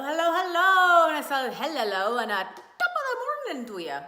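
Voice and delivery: loud, high, hammed-up Irish brogue greeting; over-the-top Irish accent, mock cheerful; bad Irish accent. Greeting viewers on Saint Patrick's Day in a hammed-up Irish accent. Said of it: "that was a really bad accent".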